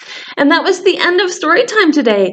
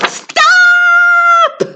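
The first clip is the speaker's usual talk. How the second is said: high shrill-free shout, much higher and louder; shouting stop; 4 dB louder than usual. Acted. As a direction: yell it to halt everyone. Character shouts stop in story.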